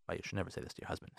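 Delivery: fast whispered aside to the listeners; hushed aside. Real, not acted. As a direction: drop to a quick whisper, as if sharing a secret. Quick hushed aside to audience.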